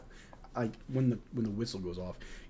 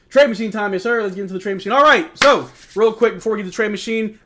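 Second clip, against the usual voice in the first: higher and louder announcer-style hype; hyped; 7 semitones higher, 11 dB louder than usual. Real, not acted. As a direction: announce the segment to chat. Hyping trade machine segment.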